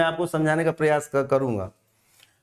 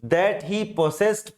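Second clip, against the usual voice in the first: slow dictation; much slower than usual. Shown as a demonstration. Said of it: "दूसरा है".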